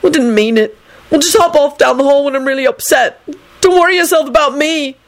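indignant hollering. A narrator's reading. Neville shouts down the hall after Trevor, indignant holler.

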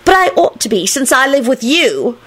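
put-on high, brazen girl's retort; high, indignant admission; unembarrassed. A narrator's reading. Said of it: "without the slightest vestige of embarrassment".

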